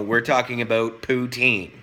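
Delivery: ordinary talk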